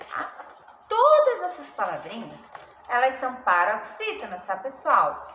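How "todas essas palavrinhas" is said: ordinary delivery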